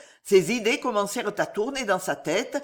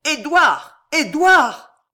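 high, loud calling of the name; anguished shout; 9 semitones higher, 10 dB louder, much slower than usual. A narrator's reading. Father rushes out calling his son, anguished shouts. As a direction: shout for your child in anguish. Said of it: "prononcé avec un tel accent d'angoisse".

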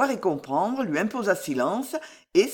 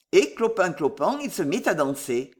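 ordinary delivery

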